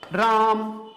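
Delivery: राम with the आ stretched over three beats; drawn-out call. Shown as a demonstration. Teacher demonstrating pluta on राम, vowel held long. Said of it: "हम इसको कैसे लिखेंगे".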